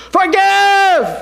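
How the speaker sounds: high loud cry of forgive; shouted forgive. Retold or imitated. Pastor crying out to the chamber, high ringing shout. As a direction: ring out forgive across the hall. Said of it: "And when the next yes vote was cast".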